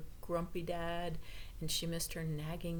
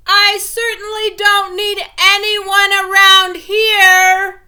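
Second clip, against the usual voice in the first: much higher, very loud and slow; said right out loud; 14 semitones higher, 22 dB louder, slower than usual. A narrator's reading. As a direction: proclaim it to the empty house, loud and high. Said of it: "said right out loud".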